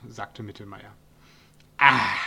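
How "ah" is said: a loud satisfied ah after a beer; contented sigh. A narrator's reading. Dwarf enjoying his first sip of beer, loud contented sigh. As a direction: sigh out with relish. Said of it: "seufzte der Zwerg".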